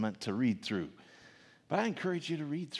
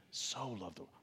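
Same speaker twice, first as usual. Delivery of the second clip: hushed emphasis; 12 dB quieter than usual. Shown as a demonstration. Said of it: "put the emphasis on a different word".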